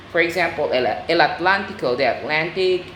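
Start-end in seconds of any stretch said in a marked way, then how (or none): 0.9-2.0 "Atlántico" in Spanish, aht-LAHN-tee-koh; Spanish pronunciation. Shown as a demonstration. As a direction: say "el Atlántico" as Spanish, stressing "lán". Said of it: "just add an O".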